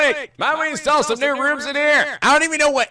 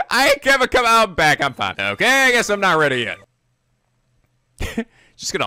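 ordinary talk

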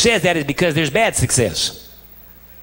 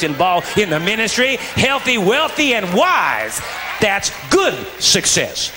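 fervent preaching crescendo; rising preacher shout; 3 semitones higher, slower than usual. Real, not acted. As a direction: pitch climbs higher word by word to a shout.

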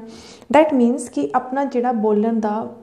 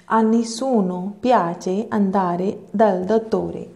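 very slow, each Italian word set apart; slow model reading; much slower than usual. Shown as a demonstration. Teacher reads the Italian phrase slowly to her students. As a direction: read it out slowly for the class to copy. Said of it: "ਤੁਸੀਂ ਧਿਆਨ ਦਿਓ ਕਿ ਮੈਂ ਕਿੱਦਾਂ ਪੜ੍ਹ ਰਹੀ ਹਾਂ".

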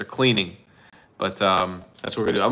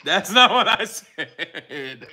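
laughing denial; 3 dB quieter, much slower than usual. Real, not acted.

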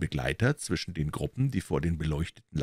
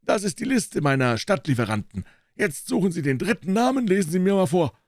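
higher, pushy dealer lecturing a doubter; triumphierender Händler; 8 semitones higher, 4 dB louder than usual. Acted. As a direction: make him read the proof aloud. (auftrumpfender Händler, hohe Stimme)

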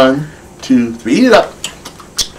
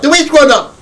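high storyteller voice; 11 semitones higher than usual. Acted. Puppet show storytelling to kids.

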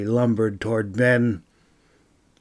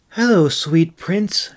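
high, sweet coo to the dragon; light high cooing greeting; 6 semitones higher, 4 dB louder than usual. Acted. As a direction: coo it like sweet talk to a pet. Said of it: "May cooed".

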